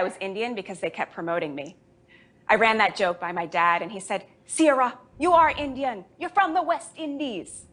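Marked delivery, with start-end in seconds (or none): 4.5-7.7 high Jamaican dad voice that slips toward German; a botched Jamaican accent, higher; failed Jamaican accent. Retold or imitated. Dad corrects her, in a botched Jamaican accent. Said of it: "I sounded German that time".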